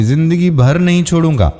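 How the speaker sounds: plain reading voice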